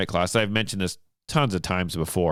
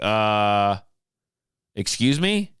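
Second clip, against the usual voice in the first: rising, baffled excuse me; half-shocked polite protest; startled excuse me; much slower than usual. Retold or imitated. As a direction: caught off guard at gunpoint. Woman aims shotgun at him.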